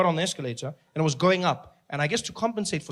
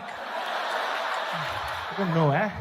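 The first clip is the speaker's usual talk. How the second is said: laughing; 3 semitones lower, much slower than usual. Real, not acted. Laughing, mock Siri answer.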